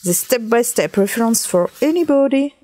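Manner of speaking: English read out with a French accent. Real, not acted. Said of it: "Excuse me for my accent English".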